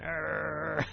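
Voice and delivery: a short angry growl through bared teeth; mock growl. Preacher imitating an angry person, mock growl. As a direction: growl like someone about to snap. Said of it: "teeth are showing in a growling fashion".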